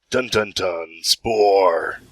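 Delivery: slow, low ominous intonation; ominous dramatic build-up. Pretend. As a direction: drop it in like a movie trailer. Mock ominous build-up to Spore.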